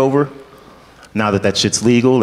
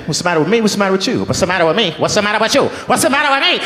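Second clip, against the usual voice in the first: put-on accent sliding to Italian; 7 semitones higher, much faster than usual. Actor losing his accent mid-audition, sliding into mock Italian. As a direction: let the accent slip steadily towards cartoon Italian. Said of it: "not sure where the Italian comes from".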